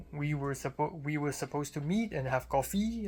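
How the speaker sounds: imitated French accent, last syllable lifted. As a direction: mimic her French accent, COFFEE rising at the end. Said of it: "makes the last syllable high".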